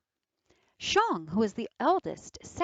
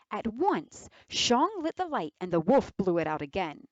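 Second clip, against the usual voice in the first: plain reading voice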